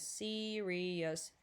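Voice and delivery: ordinary talk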